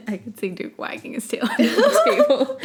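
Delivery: ordinary delivery, nothing marked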